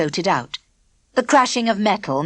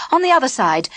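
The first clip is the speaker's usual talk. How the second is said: usual speaking voice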